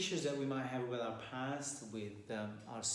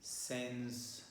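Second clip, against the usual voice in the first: softer, unhurried meditation voice with long pauses; guided meditation; 2 semitones lower, 8 dB quieter than usual. Acted. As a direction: guide listeners calmly into their breath and posture.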